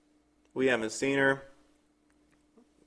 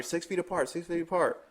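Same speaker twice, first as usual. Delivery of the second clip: broken English; 6 semitones higher, 4 dB quieter than usual. Retold or imitated. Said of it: "she has broken English".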